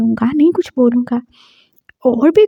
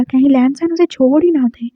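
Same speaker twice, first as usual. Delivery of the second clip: ordinary delivery, nothing marked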